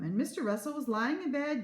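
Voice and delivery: usual speaking voice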